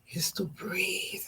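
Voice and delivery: slow, hushed whisper; breathy whisper. Shown as a demonstration. Mom showing how she and her children relax, breathy whisper. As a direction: let the words out on a slow breath.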